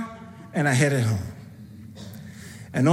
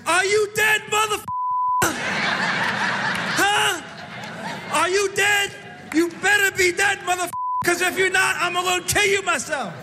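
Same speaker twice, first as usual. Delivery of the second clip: cursing the dead; 8 semitones higher than usual. Retold or imitated. Driver yelling at the body in the hearse mirror, high laughing mock yell. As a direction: scream threats at the corpse like you mean it half-jokingly. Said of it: "curse at 'em".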